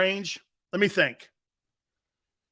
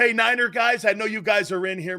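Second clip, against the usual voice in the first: ordinary talk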